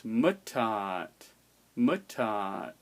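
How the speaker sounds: "mutat" as MUtat, slowly; stress on first syllable. Shown as a demonstration. Teacher correcting to stress on the I, slow MUtat. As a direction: raise the first vowel of "mutat" and let "tat" fall. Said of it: "it's pronounced on the I".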